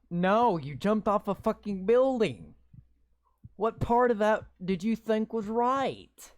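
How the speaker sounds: loud exasperated disbelief; incredulous yelling. Real, not acted. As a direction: yell at the story for being stupid. Arguing with game text.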